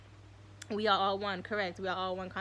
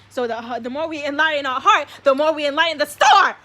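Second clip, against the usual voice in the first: building to a much louder, higher rapturous cry; overwhelmed excitement; 6 semitones higher, 13 dB louder than usual. Real, not acted. Streamer carried away by his star theory, getting louder and higher until he yells. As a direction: let the thrill run away with you, rising to a yell.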